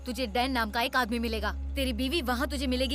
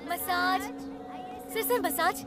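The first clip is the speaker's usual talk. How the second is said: street tout; 5 semitones higher, 4 dB quieter, much slower than usual. Acted. Masseuse touting to passing customers.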